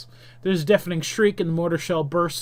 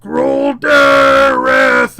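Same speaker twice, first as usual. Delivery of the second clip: very slow, high, huge shout; drawn-out monster roar; howling demon; 8 semitones higher, 13 dB louder, much slower than usual. Acted. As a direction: howl the summons in a demon voice. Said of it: "it howls".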